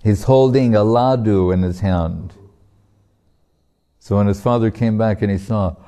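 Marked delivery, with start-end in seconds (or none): none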